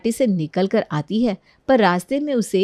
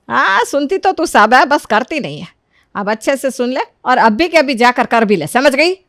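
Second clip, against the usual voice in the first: higher, louder scolding; stern mother; 4 semitones higher, 5 dB louder than usual. Acted. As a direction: order her to go now. Mother ordering her daughter. (बेटी को सख्ती से हुक्म देती माँ)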